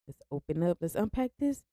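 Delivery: soft singsong climbing very high on TRAUMA; quiet, teasing high-pitched singsong; playful singsong. Real, not acted. Jokingly announcing a heavy topic.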